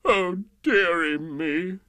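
slow, loud, high-pitched mock sob; mock sobbing king. A narrator's reading. King denied his butter, theatrically sobbing. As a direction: wail it as a hammed-up sobbing king. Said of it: "The King sobbed".

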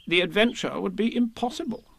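mildly weighty pronouncement, a little high; solemn. A narrator's reading. Rabbit planning the adventure, solemnly. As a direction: declare it with importance. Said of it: "said Rabbit solemnly".

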